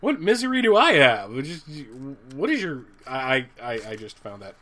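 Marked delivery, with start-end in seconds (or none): 0.0-1.3 higher and louder, mock baffled protest; indignant retort. Hero mocking the villain's threat, louder baffled protest. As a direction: throw the question back in disbelief. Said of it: "it's just like".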